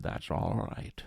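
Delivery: breathy, slushy Scottish voice; unbothered mock Scottish brogue; Connery-style Scottish reply. Connery shrugging off the bandleader, in a Scottish accent.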